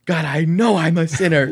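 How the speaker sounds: loud, high acted cry to God; hammed-up yelled confession; mock yelled prayer. Acting out his yelled prayer.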